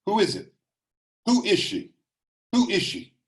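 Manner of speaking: high, sharp demanding cry; mother's yelling. A narrator's reading. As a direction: read as Mama yelling questions in the night. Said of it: "it's Mama's yelling that woke me up".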